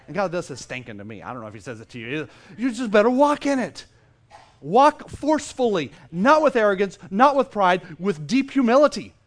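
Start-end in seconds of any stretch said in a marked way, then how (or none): 2.6-9.2 loud, raised-pitch exhortation; forceful preaching. Real, not acted. Preacher charging the congregation to walk in authority, forceful and loud. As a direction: drive each phrase home hard.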